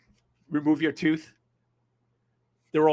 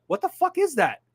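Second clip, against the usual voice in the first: incredulous, higher and louder retold panic; shocked disbelief; 11 semitones higher, 4 dB louder than usual. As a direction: pitch flies way up in retold shock. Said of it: "and I'm like".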